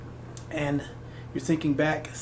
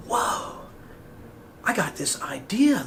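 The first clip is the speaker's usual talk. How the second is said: unvoiced whispered "Whoa"; awed whisper; slower than usual. You, alone, whispering a sudden idea to yourself. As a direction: breathe it out in a hushed whisper of discovery.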